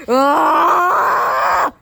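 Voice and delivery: long, loud high scream; exasperated scream. Acted. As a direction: scream in frustration. Knuckles told to go check.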